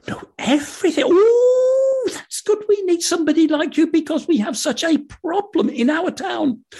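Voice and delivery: high eager villager voice; excited high townsman. Acted. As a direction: gush like a desperate villager.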